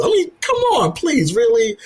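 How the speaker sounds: high-pitched, incredulous pleading. Retold or imitated. Acting out his bemused thoughts at kangarooing.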